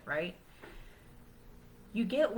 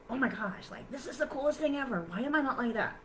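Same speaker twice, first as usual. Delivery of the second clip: high and fast, gushing with joy; playfully thrilled outburst; excited; 6 semitones higher, faster than usual. Retold or imitated. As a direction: rush it out like a thrilled kid. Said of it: "happy and excited".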